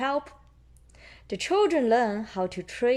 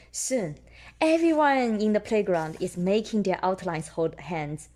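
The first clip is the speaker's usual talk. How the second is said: usual speaking voice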